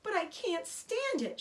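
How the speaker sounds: high, slow, pleading shout; a pig refusing to accept it; desperate shout. A narrator's reading. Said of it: "shouted Wilbur".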